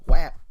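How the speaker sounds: a sudden loud spoken slap sound; loud slap noise. Pretend. Mocking the movie dad, a loud spoken whap. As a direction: smack it out like a slap.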